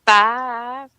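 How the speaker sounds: "Five" drawn out Southern style; Southern "Five" for the chat; drawled five. Shown as a demonstration. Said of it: "Yeah, like that".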